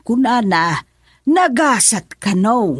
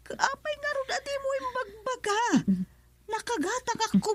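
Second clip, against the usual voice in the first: distressed mother; 11 dB quieter, faster than usual. Acted. Mother distressed by son's illness.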